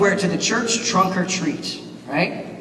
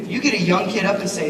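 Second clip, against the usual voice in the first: normal talking voice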